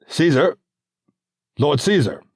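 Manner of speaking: pleading senator calling out; calling out to Caesar. Acted. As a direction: try to be heard over the crowd. Olympius calls for attention.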